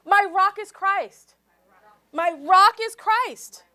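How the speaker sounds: loud, slow declaration of faith. Shown as a demonstration. Preacher leading the congregation in a loud vow. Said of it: "Let's say".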